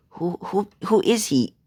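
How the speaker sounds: high, halting, bewildered question; flustered stammer. Acted. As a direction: stammer it out, thrown off. Flustered mother asks after being thrown.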